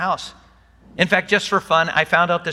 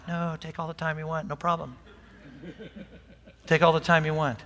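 reassuring; 6 dB quieter, faster than usual. Retold or imitated. Reassuring the apologizing pilot.

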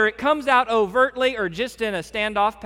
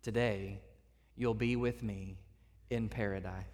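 much softer and lower reassurance; Jesus gently promising the dying thief; tender promise; 8 semitones lower, 14 dB quieter than usual. Retold or imitated. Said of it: "Jesus looks at him and says".

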